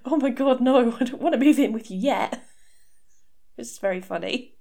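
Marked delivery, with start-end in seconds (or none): 0.1-2.4 higher, louder, alarmed protest; panicked boyfriend. Retold or imitated. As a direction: blurt it out in mock panic. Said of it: "the fear on his face".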